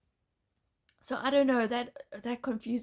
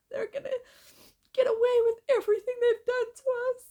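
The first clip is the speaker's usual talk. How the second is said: sobbing fear; 10 semitones higher, 3 dB louder than usual. Real, not acted. Despair over no justice.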